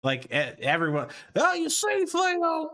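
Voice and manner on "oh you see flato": high, throaty impression voice; Bill Cosby. Shown as a demonstration. Streamer shows how the impression is done, high throaty caricature. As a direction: push it high from the back of your throat. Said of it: "do it from the back of your throat".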